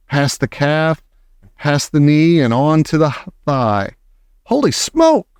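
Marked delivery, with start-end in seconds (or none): 4.4-5.3 very high startled yell. A narrator's reading. Cook with a mouse climbing his leg, yelling. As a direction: exclaim in alarm, pitch leaping. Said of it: "the man was yelling".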